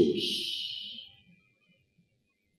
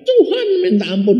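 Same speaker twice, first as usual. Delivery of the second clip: begging wife; 3 dB louder, faster than usual. Retold or imitated. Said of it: "dia bilang".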